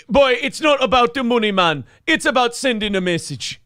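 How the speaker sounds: high-pitched Jamaican patter; Jamaican accent. Acted. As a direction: put on a comic Jamaican accent. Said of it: "My accent got fucked up".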